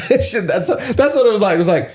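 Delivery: words broken by high laughter; laughing. Real, not acted. Laughing at the book title mix-up.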